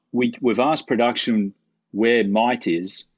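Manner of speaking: slow, spaced-out delivery as if to someone who can't follow; very slow speech. Retold or imitated. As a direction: imitate someone speaking very slowly. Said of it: "he spoke very slowly".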